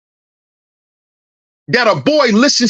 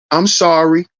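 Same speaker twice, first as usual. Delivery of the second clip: lower, small mock apology; sheepish boy mumbling sorry; lightly voiced scared teen; meek son; 5 semitones lower than usual.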